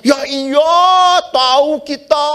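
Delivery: high, loud gossipy woman's voice; shrill it up like the ladies who know everything; mock chirpy ladies shouting back; know-it-all ladies. Women answering, mock know-it-all.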